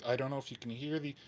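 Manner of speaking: ordinary talk, nothing marked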